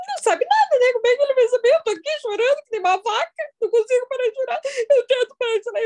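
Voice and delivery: high, loud, tearful complaining voice, half sobbing; exasperated crying rant. Retold or imitated. Recalling her thoughts about the doctor, loud tearful high-pitched whining. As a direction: whine it out like someone who can't stop crying. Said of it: "E eu pensei".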